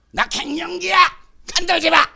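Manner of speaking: "don't touch me" mock outburst; menopausal outburst. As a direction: play a touchy menopausal wife lashing out. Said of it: "남편한테만 소리 지르고".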